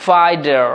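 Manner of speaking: ফাইডার with d for t and sounded r; American pronunciation. Shown as a demonstration. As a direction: say ফাইডার as "fai-derr". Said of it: "টটাকে ড করে".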